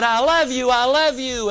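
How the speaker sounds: lightly voiced boy shouting love across the valley; child calling out. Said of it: "the little boy ran out and he said".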